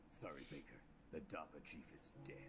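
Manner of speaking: low, soft, grave delivery; low grim Snake. Acted. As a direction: break bad news gravely.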